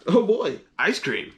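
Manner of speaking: thrilled at the sight of a treat; excited elephant. Acted. Gerald excited about ice cream.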